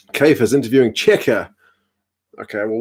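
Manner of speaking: ordinary talk, nothing marked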